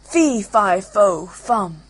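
loud booming ogre chant; roaring hungry ogre; ogre's cry. Acted. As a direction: sniff out the Englishman, bellowing. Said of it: "cried out the ogre".

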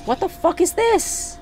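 very high-pitched, incredulous outrage; angry disbelief. Real, not acted. Player baffled by damage on the farm, angry disbelief. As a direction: squeal the question in angry disbelief. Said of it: "Oh, I'm mad".

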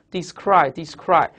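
"descry" read slowly, each time drawn out; repeated reading aloud. Shown as a demonstration. Teacher having students memorize by sound, slow repeated reading. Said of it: "你读三遍".

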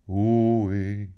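calling out. A narrator's reading. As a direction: hail the gods to start the song.

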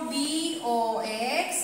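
slow, drawn-out letters; each letter held long; spelling out. Shown as a demonstration. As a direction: spell it slowly for the class. Teacher spells word for pupils.

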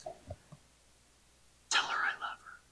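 soft, slow whisper; hushed, drawn-out whisper; little voice. Acted. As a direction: whisper it like a small inner prompting. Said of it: "you hear this little voice".

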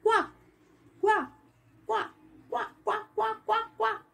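high little quá quá quá; duckling finally quacking right. Acted. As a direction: quack proudly like a baby duck. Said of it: "e tentou".

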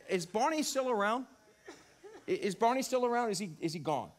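usual speaking voice

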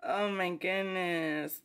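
ordinary talk

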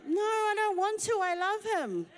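pleading friend. Retold or imitated. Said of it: "they're like".

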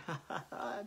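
low, quiet laugh to oneself; soft chuckle. Real, not acted. Speaker laughing as she remembers her old church, soft chuckle. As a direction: chuckle softly at a memory.

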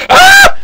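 sudden loud high yelp; startled yelp. Real, not acted. Gamer yelping at a crash on screen, startled yelp.

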